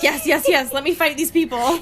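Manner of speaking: a brawler begging for the fight; excited Emmett. Retold or imitated. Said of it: "Emmett gets so excited. He's just like".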